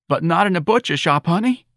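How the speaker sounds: high, plaintive mother voice; grief-stricken. Acted. Mrs. Riley telling Santa about the job, grieving. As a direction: lament with understated grief. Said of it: "her voice heavy with grief".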